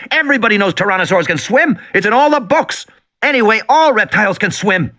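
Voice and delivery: put-on high bratty girl voice; girl's voice. A narrator's reading. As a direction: voice the little girl, dismissive. Voicing Lex.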